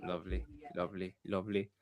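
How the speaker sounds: quick murmur. Real, not acted. Reacting to friend's closing words.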